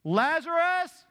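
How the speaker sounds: Jesus shouting into the tomb; loud call. Acted. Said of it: "Jesus called out in a loud voice".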